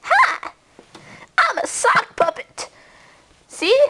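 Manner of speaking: lightly voiced sock puppet; chirpy little puppet greeting; goofy muffled sock puppet. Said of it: "That's not scary".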